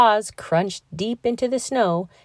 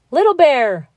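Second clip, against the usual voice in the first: higher, louder call of a name; 7 semitones higher, 6 dB louder than usual. A narrator's reading. Squirrel calling Little Bear to dig acorns, loud call. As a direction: squirrel calling the cub over. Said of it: "called Tuft Tail Squirrel".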